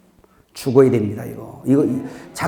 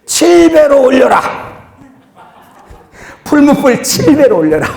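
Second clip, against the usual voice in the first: high, loud bellowed order; shouted divine command; 9 semitones higher, 7 dB louder than usual. Acted. The Lord ordering angels to heat the furnace, shouted command. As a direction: thunder it out like a master's command. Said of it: "주님이 기다려. 뭐라 그래? 천사들한테".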